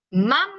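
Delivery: mam said alone as a syllable; split word. Shown as a demonstration. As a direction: break the word, give mam on its own. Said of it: "dividan la palabra".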